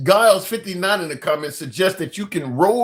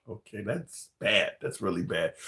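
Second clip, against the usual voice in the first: mock grave; 11 semitones lower, 12 dB quieter than usual. Reacting to harsh roast.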